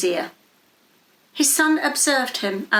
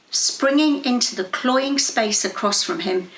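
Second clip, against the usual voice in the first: usual speaking voice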